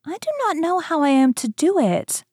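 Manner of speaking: put-on high little-girl voice; Gretel feigning ignorance. A narrator's reading. Gretel pretending not to understand the witch, high and innocent. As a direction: play innocent in a high, puzzled voice. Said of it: "Gretel saw what she had in mind and said".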